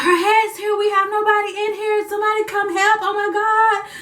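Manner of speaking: high, frantic staff voices calling for help; mock panic. Staff calling for help as the baby arrives, high mock panic. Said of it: "And everybody was like".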